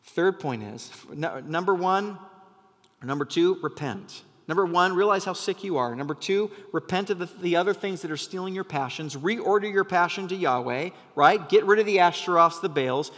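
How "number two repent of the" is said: ordinary delivery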